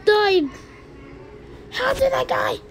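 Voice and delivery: very high disbelieving cry; high-pitched outrage; shocked squeal. Real, not acted. Dying in game.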